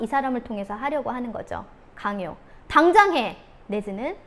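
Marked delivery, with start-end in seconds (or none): 2.7-3.4 loud, high snapped order; 엄하게 명령하는 엄마; harsh command. Shown as a demonstration. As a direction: order the child, no room for talk. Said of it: "이런 식으로".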